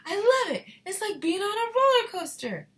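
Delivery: put-on high, loud excited boy; thrilled shout. A narrator's reading. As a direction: shout it like riding a roller coaster. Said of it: "Charlie shouted".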